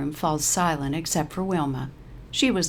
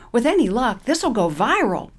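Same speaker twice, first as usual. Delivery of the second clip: put-on higher, louder young server; server's voice; 7 semitones higher, 6 dB louder than usual. The food server jokes to Wilma, higher and louder. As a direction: tease with a wink.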